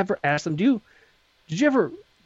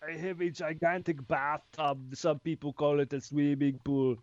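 Schwarzenegger voice; 2 semitones lower, 7 dB quieter than usual. Pretend. Arnold impression, slow and soft.

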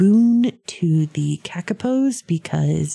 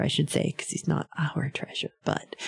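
whispered aside; 8 dB quieter than usual. Real, not acted. To listeners, a sheepish correction in a whisper. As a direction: drop to a breathy whisper for the aside.

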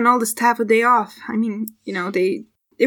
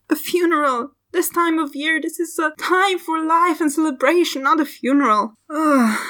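higher-pitched, acted tearful fretting; distraught dismay; 6 semitones higher than usual. Grieving servant dreads a funeral at festival time, distraught. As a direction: wail lightly in dismay, ending on a groan.

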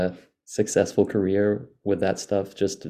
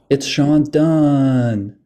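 announcer reveal; 5 semitones higher, 7 dB louder, much slower than usual. Acted. Dramatic guest reveal.

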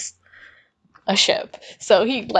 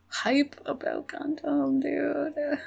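much softer, slow and drawn out; awed fan; 3 semitones higher, 12 dB quieter, slower than usual. Real, not acted. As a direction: let it sink to a slow hush. Worn-out fan still hyped.

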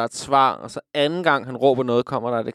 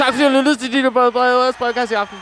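excited sign-off; 9 semitones higher, faster than usual. Real, not acted. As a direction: rush the goodbye in delight. Host laughing-shouting sign-off.

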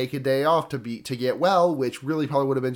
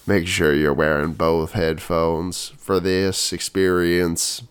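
mock ASMR voice; 8 semitones lower than usual. Podcaster pretending to give listeners an ASMR experience, deep voice. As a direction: croon deep and deliberate into both headphones. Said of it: "This is some A S M R".

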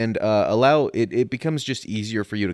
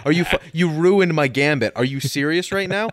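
exasperated laughing rant; 6 semitones higher, 6 dB louder than usual. Real, not acted. Exasperated at being interrupted.

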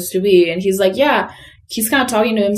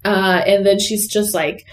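usual speaking voice

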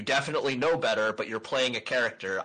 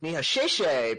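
mock foreigner Mandarin; 4 semitones higher, 4 dB louder than usual. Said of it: "or like in Mandarin".

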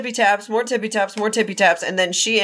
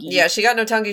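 ordinary delivery

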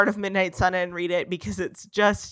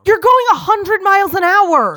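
louder, higher panicked yell at the driver; 8 semitones higher, 6 dB louder than usual. Acted. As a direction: shout at him in fear. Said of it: "She yelled at me".

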